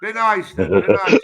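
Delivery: stern loud warning. Real, not acted. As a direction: bark it out as a threat. Drunk man threatening his neighbour.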